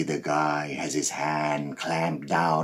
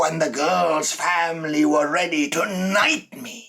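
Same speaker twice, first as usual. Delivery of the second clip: building triumphant shout; 11 semitones higher, 8 dB louder than usual. Acted. Killer recalls being hailed a hero, rising triumphant shout. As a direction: proclaim it higher and higher, boasting.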